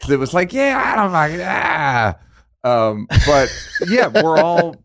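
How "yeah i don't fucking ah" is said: delighted mock shout. Acting out own delighted reaction.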